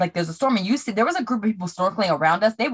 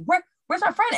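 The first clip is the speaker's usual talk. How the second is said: high, frantic calling out for a lost friend; much higher, searching the waves in alarm; panicked calling; 11 semitones higher than usual. Retold or imitated. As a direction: call out in rising panic.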